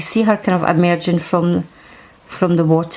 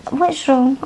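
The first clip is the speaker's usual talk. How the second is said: usual speaking voice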